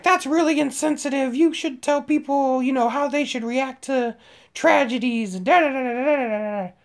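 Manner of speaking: high-pitched imitation of a lecturing teacher; mock the scolding, high and running off into blather. Mimics the teacher scolding him.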